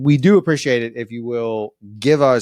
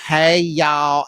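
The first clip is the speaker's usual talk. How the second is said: higher-pitched attempt at a Mississippi accent; 6 semitones higher, 4 dB louder than usual. Interviewer trying Randa's talk, exaggerated Mississippi accent. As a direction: put on a Mississippi drawl, valley-girl style. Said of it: "how does Randa talk?".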